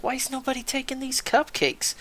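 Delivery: high-pitched mock disbelief; incredulous. Retelling his disbelief at cupcakes.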